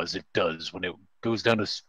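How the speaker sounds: usual speaking voice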